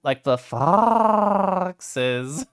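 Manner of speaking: "foxes" drawn out very slowly with a trilled R pushed in; rolled R in foxes. Shown as a demonstration. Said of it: "in the middle of a word that doesn't have it".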